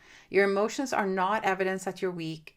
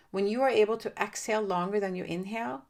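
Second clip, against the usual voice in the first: ordinary talk, nothing marked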